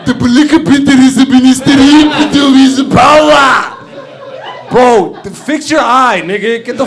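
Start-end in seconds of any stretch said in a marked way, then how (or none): 0.0-3.7 mock African accent, higher and louder; booming fake African-accent speech; Forrest Whitaker's accent. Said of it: "Why is Forrest Whitaker talking like this".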